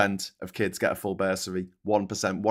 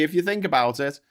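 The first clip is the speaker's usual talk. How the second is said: ordinary delivery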